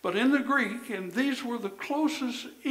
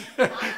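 loud laugh; 4 semitones higher, 7 dB louder than usual. Real, not acted. Laughs at own joke.

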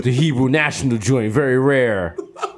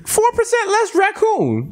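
much higher, slow mock pitchman; mock ad slogan; slower than usual. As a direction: sell it like a cheesy ad.

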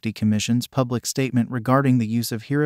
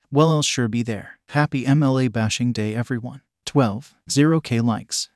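ordinary delivery

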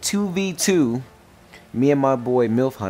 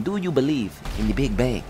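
a lightly put-on Russian-Saudi Arabian accent, a bit quieter; mock Russian Saudi Arabian accent; 4 dB quieter than usual. Gamer trolling an opponent, light mock Russian-Saudi Arabian accent. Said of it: "my little Russian Saudi Arabian voice".

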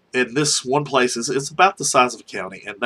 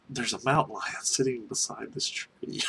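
much softer, after a long pause; retold hushed disbelief; 9 dB quieter than usual. Driver tells coworker about the mountain lion, hushed. Retells stunned sighting of lion.

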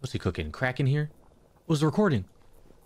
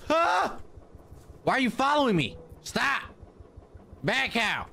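mock-scared high cries; startled high yelps; 11 semitones higher than usual. Real, not acted. Player fleeing a chasing cow, high yelps.

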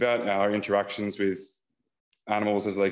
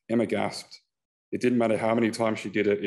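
ordinary delivery, nothing marked

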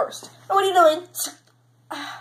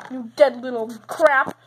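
ordinary talk, nothing marked

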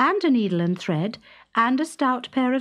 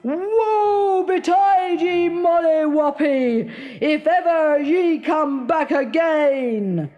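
slow, high and loud menace; dreadful threat; 7 semitones higher, 4 dB louder, slower than usual. Acted. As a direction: curse her across the bridge. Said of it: "in a dreadful voice".

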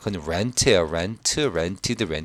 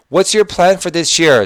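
very slow, louder, higher model reading; slow read-aloud; 9 semitones higher, 8 dB louder, much slower than usual. Shown as a demonstration. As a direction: read slowly and clearly for the class. Teacher reads dialogue line. (천천히 크게 읽기)

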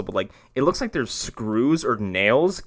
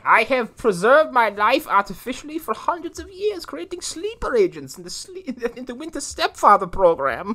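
mock villain; 11 semitones higher than usual. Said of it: "him being like".

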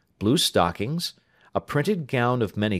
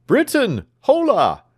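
high, loud hail; doctor hollering for his servant; calling out; 13 semitones higher, 7 dB louder than usual. Acted. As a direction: shout him out of the house. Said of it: "cried the doctor".

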